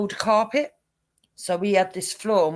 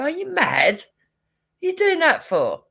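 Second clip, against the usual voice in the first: higher, louder everyday "Are you mad?"; casual British exclamation; 7 semitones higher, 4 dB louder than usual. Shown as a demonstration. Briton reacting to a friend's odd act, in a light mock-exasperated tone. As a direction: toss it off as friendly British banter. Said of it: "you go".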